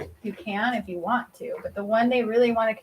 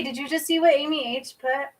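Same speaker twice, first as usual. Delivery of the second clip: normal talking voice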